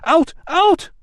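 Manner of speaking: very high, loud repeated scream; furious bathers screaming. Acted. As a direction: chase him out screaming. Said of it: "screamed his tormentors".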